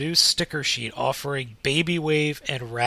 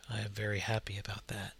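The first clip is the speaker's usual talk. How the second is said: whispering; 5 semitones lower, 13 dB quieter, faster than usual. Real, not acted. Whispered aside about food.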